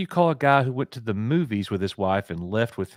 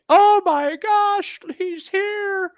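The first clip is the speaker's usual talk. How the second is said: gushing fan squeal, very high and drawn out; Valiant; 6 dB louder, much slower than usual. Acted. Coach imitating a viewer greeting the surprise guest, high excited squeal. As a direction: imitate an overexcited fan in a slow, loud falsetto. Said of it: "I know Valiant will be like".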